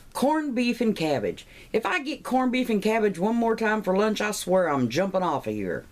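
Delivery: high-pitched exasperated outburst; fed-up complaint. Acted. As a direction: act out being sick of the same lunch. Acted fed-up lunch complaint.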